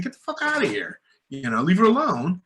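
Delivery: high mock angry rebuke; retold telling-off. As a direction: chase the cabbie off, retold. Telling off the cabbie.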